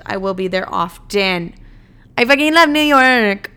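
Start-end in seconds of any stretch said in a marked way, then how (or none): 2.2-3.5 excited declaration. Real, not acted. Gushing about New York.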